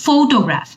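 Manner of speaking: Photograph with the weight on ফ; stress on first syllable. Shown as a demonstration. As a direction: hit the ফ at the start of photograph. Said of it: "ফার্স্টের অংশটাতে বেশি জোর দিচ্ছি". (প্রথম অংশে জোর দিয়ে ফটোগ্রাফ)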